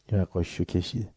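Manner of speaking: ordinary talk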